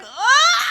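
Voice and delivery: high, rising cry; acted strain of one big push; pushing scream. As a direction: let out a high labour wail.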